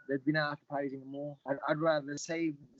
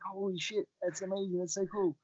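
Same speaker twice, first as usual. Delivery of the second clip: amazed; 5 semitones higher than usual. Retold or imitated. Retold reaction to trending news.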